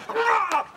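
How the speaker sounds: short, high "rah" yell; sudden high-pitched roar; high angry roar. Acted. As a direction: let out a comic roar of anger. Growl with angry self five.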